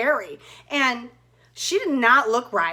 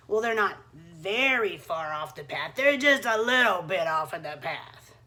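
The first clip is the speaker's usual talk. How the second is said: tricky wolf; 8 semitones lower than usual. Acted. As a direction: coax her off the path, low and sly. Tricky wolf coaxes Red.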